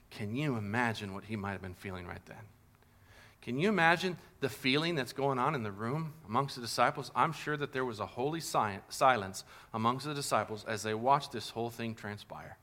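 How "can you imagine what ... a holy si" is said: usual speaking voice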